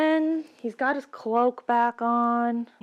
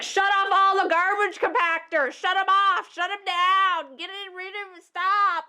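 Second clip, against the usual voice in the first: frantic yelling; 7 semitones higher than usual. Acted. Luke yelling at the droids to shut compactors, high yell. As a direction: bark orders in a panic.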